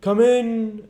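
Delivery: high, loud booming welcome; jolly giant ghost exclaiming. Acted. Ghost greets timid Scrooge at the door, high and loud. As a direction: call him in like a hearty giant. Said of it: "exclaimed the ghost".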